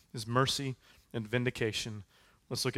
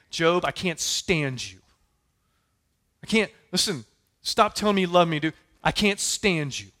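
friends' contempt for Job; 4 semitones higher, 6 dB louder than usual. Acted. Friends telling Job to his face they can't stand him, louder scornful voice. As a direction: spit out the rejection like a fed-up friend. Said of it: "and they're saying".

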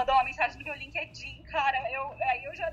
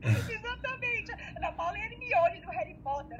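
imitating her father; 8 semitones higher than usual. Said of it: "Meu pai".

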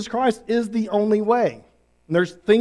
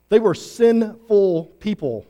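"sinful" slow, with the weight on "-ful"; emphasis on full; slower than usual. Shown as a demonstration. Preacher to the congregation, stressing the "full" in "sinful". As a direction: stretch "sinful", landing on "full". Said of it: "with emphasis on the full".

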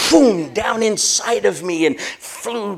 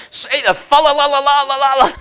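higher, louder chanted command; insistent preacher; 7 semitones higher, 6 dB louder than usual. Retold or imitated. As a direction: push him to chant it. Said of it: "And she's".

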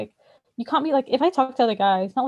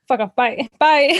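dismissive brush-off; 2 semitones higher, 6 dB louder, slower than usual. Retold or imitated. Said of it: "And I was like".

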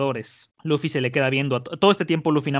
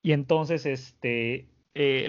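normal talking voice

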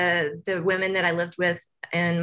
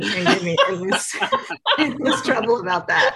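laughing through the words; half-laughing worried joke; 5 semitones higher than usual. Real, not acted. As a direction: giggle while saying it. Laughing about getting in trouble.

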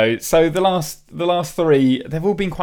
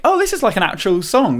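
impressed surprise; 8 semitones higher than usual. Retold or imitated. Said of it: "there's always a couple that you're like".

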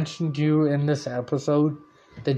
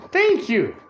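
high-pitched mock gratitude; lightly hammed-up, high thank-you; retold grateful thanks; 8 semitones higher than usual. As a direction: thank them as the won-over guards. Retold guards gladly accept tickets.

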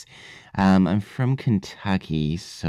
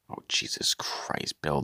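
exasperated whisper; 2 semitones lower, 7 dB quieter than usual. Real, not acted. Host exasperated off air.

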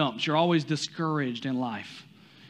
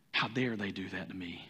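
mock muttered grumble; 8 semitones lower, 8 dB quieter than usual. Angry driver's inner thought.

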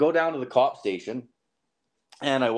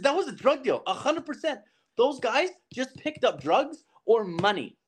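much higher, hammering it home; even more excited insistence; 12 semitones higher than usual. Retold or imitated. Insisting to his stunned buddy it was a drug deal, much higher.

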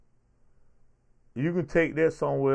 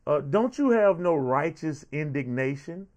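usual speaking voice

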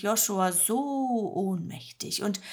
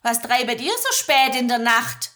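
louder Swabian woman, kindly asking; friendly farm woman; 3 semitones higher, 8 dB louder than usual. Acted. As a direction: greet the strangers warmly in dialect. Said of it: "fragt sie freundlich". (freundliche Bäuerin, schwäbisch)

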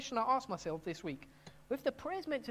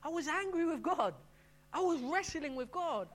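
agitated protest; 6 semitones higher, 3 dB louder than usual. Retold or imitated. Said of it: "And the guy says".

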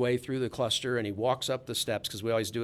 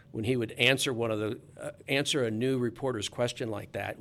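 normal talking voice

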